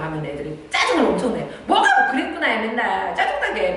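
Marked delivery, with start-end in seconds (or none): 1.7-3.8 much higher, louder, laughing mock complaint; annoyed child. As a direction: whine at mom's stock phrase, fed up. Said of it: "막 이렇게 하거든요".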